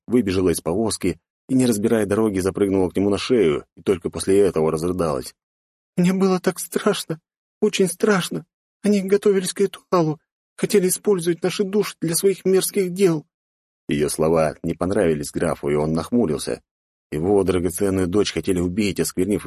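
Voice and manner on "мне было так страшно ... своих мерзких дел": put-on very high girl's voice; frightened daughter. Rescued daughter tells her father of the ritual, high frightened voice. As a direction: voice a scared girl telling her ordeal.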